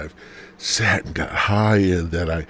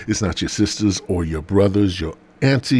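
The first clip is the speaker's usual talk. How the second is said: ordinary delivery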